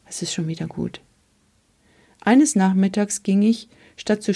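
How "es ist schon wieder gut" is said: much softer, barely voiced, trying to smile; smiling whisper. A narrator's reading. Mother quietly reassuring her son with a smile. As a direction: hush him gently with a tired smile. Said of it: "versuchte sie zu lächeln und flüsterte".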